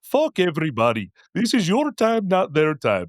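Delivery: high-pitched, hammed-up Indian accent; horrible Indian accent. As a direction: preach it in a bad Indian accent. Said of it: "that's a horrible fucking accent".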